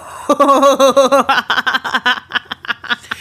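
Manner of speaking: a hearty burst of laughter. Real, not acted. Hearing about the creeper dude, she bursts out laughing.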